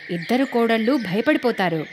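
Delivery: ordinary delivery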